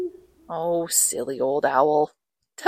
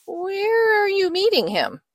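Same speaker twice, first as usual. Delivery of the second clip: nervous snake; 14 semitones higher than usual. Acted. Snake asks mouse about the Gruffalo, high nervous voice. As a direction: ask it high and uneasy.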